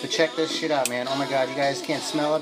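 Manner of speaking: ordinary talk, nothing marked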